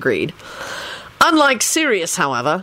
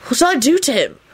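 higher-pitched exasperation; protest, exasperated; 9 semitones higher than usual. A narrator's reading. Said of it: "said in an exasperated voice".

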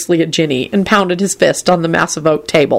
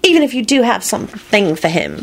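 revolted; 5 semitones higher than usual. Acted. Ginny continues revolted.

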